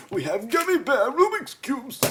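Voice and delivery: loud, high-pitched goofy shout; mock announcement. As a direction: belt it out like a silly product pitch. Playful mock gummy bear product announcement.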